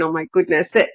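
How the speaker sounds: normal talking voice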